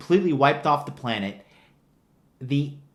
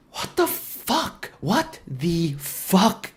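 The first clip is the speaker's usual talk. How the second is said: higher, breathy cry of disbelief; disbelieving squeal; 5 semitones higher, much slower than usual. Real, not acted. Ranting at the film's writers, a strained high squeal of disbelief. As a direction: squeak it out, unable to believe it.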